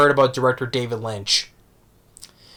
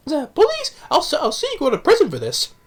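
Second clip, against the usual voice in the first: lightly hammed elderly lady protesting; indignant old lady. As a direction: scold your captors in a high old-lady voice. Old lady dragged to closet.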